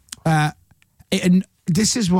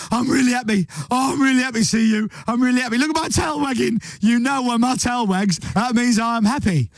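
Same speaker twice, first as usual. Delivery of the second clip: high, gushing dog voice; overjoyed dog, bubbling with excitement; happy dog; 7 semitones higher than usual. Acted. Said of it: "A dog will go".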